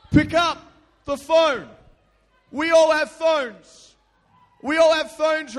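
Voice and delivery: loud, slow preaching; preacher at full force. Acted. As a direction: boom the title to the church. Preacher announces the sermon title.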